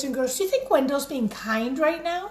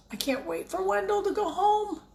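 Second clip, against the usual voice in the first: to herself; 8 semitones higher, 4 dB quieter than usual. A narrator's reading. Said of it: "she said to herself".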